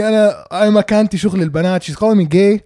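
ordinary talk

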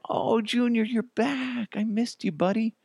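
high, gushing reunion with the cat; happy brother. Retold or imitated. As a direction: squeal it like a delighted pet owner. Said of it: "my brother is so happy".

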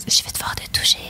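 sweet whispered seductive voice; honeyed little voice. Shown as a demonstration. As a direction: coo it in a syrupy whisper. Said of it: "Avec une petite voix mielleuse".

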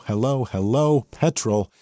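ordinary delivery

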